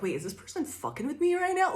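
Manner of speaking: high, quick baffled question; suddenly unsure if they're being played; confused disbelief. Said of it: "they're like".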